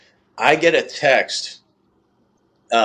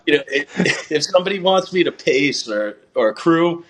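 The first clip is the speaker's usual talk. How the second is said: words bubbling with leftover laughter, higher; talking through laughter; 6 semitones higher than usual. Real, not acted. Runner still laughing as he offers to pace, words through laughter. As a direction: keep laughing as you speak.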